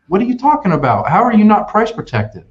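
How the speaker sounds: high, disbelieving protest; can't believe what he's hearing; stunned salesman arguing with a customer; incredulous. Real, not acted.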